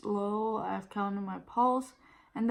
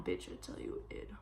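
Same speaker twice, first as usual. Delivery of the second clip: whispered aside; 13 dB quieter than usual. Real, not acted. Emphatic aside.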